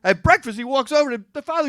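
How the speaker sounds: ordinary delivery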